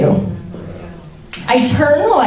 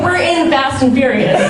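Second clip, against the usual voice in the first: normal talking voice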